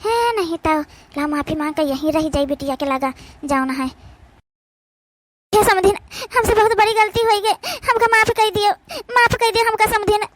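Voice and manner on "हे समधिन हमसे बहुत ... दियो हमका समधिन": high, pleading voice begging forgiveness; pleading apology. Acted. Mother begging her daughter's in-laws to forgive her, high and pleading. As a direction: beg the in-laws to forgive you, voice raised and pleading.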